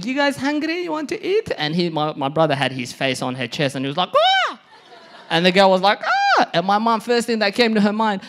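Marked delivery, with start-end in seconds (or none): 0.0-1.5 very high, loud, oblivious offer; eager offer. Retold or imitated. Mom walking in on son, high eager offer. 4.2-4.6 short, very high, loud yelp; mock scream of being caught; startled scream. Brother caught with girlfriend, high startled scream. 6.0-6.5 very high, loud short scream; startled shriek. Retold or imitated. Girlfriend caught by his mom, high startled shriek.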